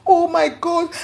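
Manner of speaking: high, tearful "oh my God"; retold crying, lifted high; imitating her crying. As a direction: voice her sobbing as she clings on.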